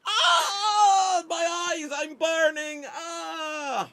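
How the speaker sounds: hammed-up high cry of pain; mock scream. Burning soldier crying out, mock scream. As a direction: shriek as if burning, comically. Character burns in sketch.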